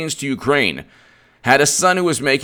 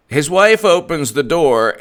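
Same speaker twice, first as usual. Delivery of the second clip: ordinary delivery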